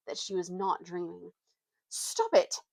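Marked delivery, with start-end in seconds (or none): 1.9-2.6 high squeaky frightened cry; squeaky with fright. A narrator's reading. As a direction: yelp it, scared and cross. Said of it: "squeaky with fright and bad temper".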